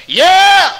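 high, loud, eager "Yeah!"; excited yell. Retold or imitated. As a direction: shout it like an eager trucker. Truck driver's eager reply.

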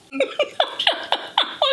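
sudden high-pitched breathy laugh; high burst of laughter. Real, not acted. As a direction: laugh out high, caught by surprise. Fish splashed her face.

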